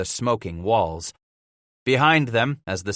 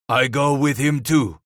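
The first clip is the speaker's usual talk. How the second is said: a mild raised shout; 4 semitones higher, 4 dB louder than usual. Acted. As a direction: call it out, moderately loud. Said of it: "Vivi shouted".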